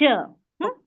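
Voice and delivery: ordinary delivery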